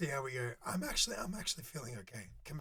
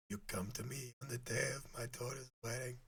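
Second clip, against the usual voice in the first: Godfather quote; 3 semitones lower, 6 dB quieter than usual. Retold or imitated. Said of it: "I was about to go redo some scenes".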